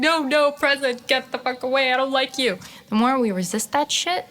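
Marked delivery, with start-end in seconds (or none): 0.1-2.6 higher, mock-petulant pushing away; playful mock indignation; rejecting the present. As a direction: shoo the moment off like a pest. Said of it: "We're like".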